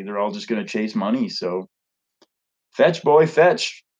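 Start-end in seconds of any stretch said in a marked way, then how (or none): 2.7-3.8 higher, louder command to a dog; mock dog command. Mocking money-chasers as dogs, a higher, louder teasing command. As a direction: talk down to them like a dog, teasing.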